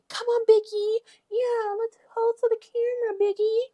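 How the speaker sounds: very high cringey sing-song voice; cringy mock. Mocking Rolly's cringy camera moment in a very high voice. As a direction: squeal it like a cringey cheerleader. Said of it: "cringy ass scene where Rolly's like".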